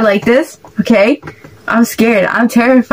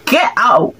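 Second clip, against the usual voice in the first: ordinary delivery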